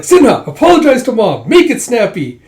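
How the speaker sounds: high, loud scolding command; bossy order. Retold or imitated. Jaeha making Sinha apologize to Mom, loud bossy order. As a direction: order him to apologize at once.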